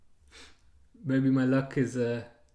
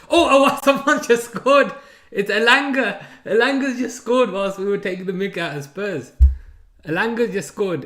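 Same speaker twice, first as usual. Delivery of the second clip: real burst of surprise, much higher and louder; excited goal reaction; 7 semitones higher, 9 dB louder than usual. Real, not acted. Football streamer reacting to a sudden goal, excited shouting. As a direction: shout it out, laughing as it breaks. Goal scored during watchalong.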